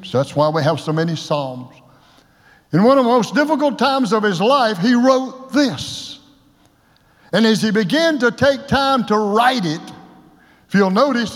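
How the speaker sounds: usual speaking voice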